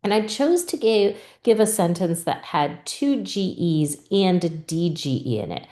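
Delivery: ordinary talk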